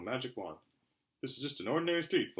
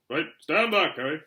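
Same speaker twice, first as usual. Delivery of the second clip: Hagrid voice; 4 semitones higher, 10 dB louder than usual. A narrator's reading. Hagrid warns Harry.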